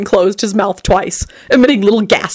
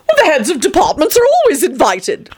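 laughing vampire; 10 semitones higher than usual. A narrator's reading. Tony answering with a laugh.

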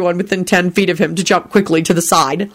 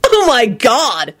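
slow, higher exasperated outburst; furious shout; 5 semitones higher, much slower than usual. A narrator's reading. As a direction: draw it out in outrage. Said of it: "Henry shouted furiously".

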